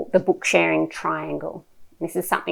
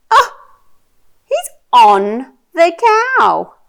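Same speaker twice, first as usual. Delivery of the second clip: animated, slow picture-book reading with big swoops; reading to a toddler; 4 semitones higher, 6 dB louder, much slower than usual. Shown as a demonstration. Mum sharing a picture book with her little one, animated child-directed reading. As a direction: draw out the words, playful and full of wonder.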